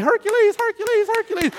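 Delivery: very high chanting cheer; high-pitched mock fan chant; mock adulation. Mimics a crowd cheering undeserved awards.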